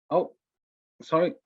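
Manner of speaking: usual speaking voice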